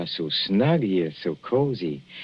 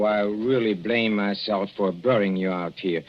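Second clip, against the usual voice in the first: ordinary talk, nothing marked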